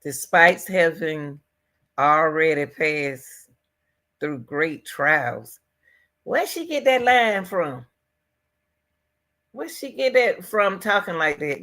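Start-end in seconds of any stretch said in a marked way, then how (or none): 0.0-5.6 each word drawn out slowly, weighing it; slow, deliberate reread. Retold or imitated. Vlogger slowly rereads the sister's strange line to viewers, deliberate. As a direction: spell out the odd phrase word by word.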